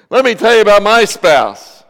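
loud, high joking jab at the spouse; mock complaint. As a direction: play up a grievance for laughs. Joking about his own wife.

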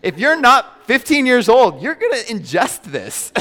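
usual speaking voice

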